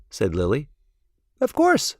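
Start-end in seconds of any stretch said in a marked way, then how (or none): 1.4-2.0 much higher, proud little voice; lightly voiced proud boy; Chester answering. A narrator's reading. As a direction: say it like it's obvious, way up. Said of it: "said Chester".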